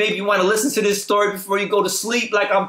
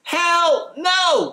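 shouted hell no; 9 semitones higher than usual. Real, not acted. Vlogger to his viewers, a high incredulous shout. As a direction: yell it out in total disbelief. Mocking the Xbox stood upright.

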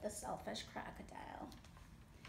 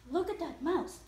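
louder, higher excited monkey; chattering monkey; 6 semitones higher, 6 dB louder than usual. A narrator's reading. Monkey points out the mouse to others, chattering. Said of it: "chattered the monkey".

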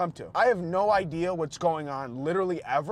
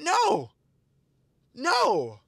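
indignant; 11 semitones higher than usual. Real, not acted. Rejecting the tip request.

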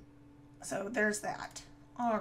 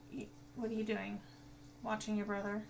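usual speaking voice

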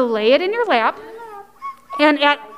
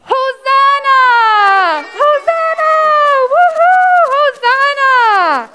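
high, loud jubilant cheers; crowd shouting; 12 semitones higher, 6 dB louder, slower than usual. A narrator's reading. People greeting Jesus as he passes, joyful shouting. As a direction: whoop it up like a celebrating crowd. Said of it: "they shouted".

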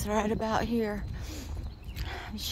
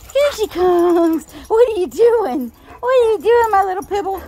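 excited pet talk; 9 semitones higher, 9 dB louder than usual. Real, not acted. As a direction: squeal at the dog. Excited at dog.

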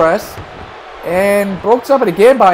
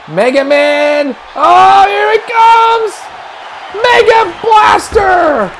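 high, stretched-out commentary cries; excited play-by-play; 9 semitones higher than usual. Real, not acted. Commentator calling Mega Man X's pin attempt, excited play-by-play. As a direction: call the near pinfall at fever pitch.

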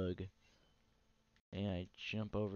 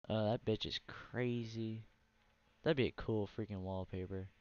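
normal talking voice

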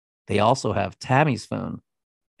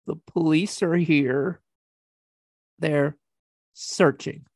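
higher-pitched whiny imitation, halting; mock whiny; 5 semitones higher than usual. Podcaster imitating husband calling wife in jail, mock whiny. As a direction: mimic a pathetic whiner reporting in. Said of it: "He's such a whiny little douchebag".

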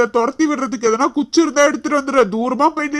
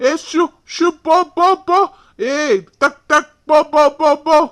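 raised, repeated shoo calls; noisy shooing; 3 semitones higher than usual. Acted. Man shows how he chases snakes, noisy shooing. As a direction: make noise to drive it off. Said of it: "sound போட்டாதான் பாம்பு போவும்".

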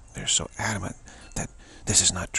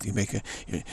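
ordinary talk, nothing marked

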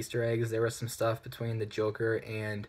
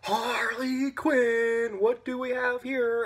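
very slow, much higher hammed-up Joker drawl; mock Joker; 11 semitones higher, 3 dB louder, much slower than usual. Reviewer mocking Jared Leto's overacting, slow hammy drawl. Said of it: "He's like".

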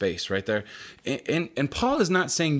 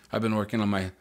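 ordinary talk, nothing marked

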